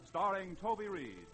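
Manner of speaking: normal talking voice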